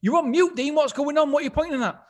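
high, loud pointing it out; mock exasperated panelist; retold panelist. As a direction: panelist telling Dean he's on mute. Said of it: "And like".

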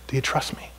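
gentle whispered question. Retold or imitated. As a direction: whisper it like an inner voice. God's voice speaking gently.

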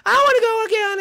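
play-acted excited child; eager demand. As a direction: burst out begging for another ride. Child eagerly demands another ride.

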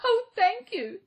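high, loud relieved gasp; gasped thanks. A narrator's reading. Girl thanks the little man, high gasping relief. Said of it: "gasped the girl".

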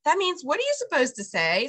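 ordinary talk

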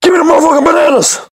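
shrill screaming demand; silly mismatched redub. Acted. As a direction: scream it way up high.

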